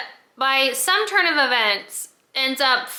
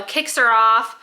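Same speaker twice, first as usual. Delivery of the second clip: ordinary delivery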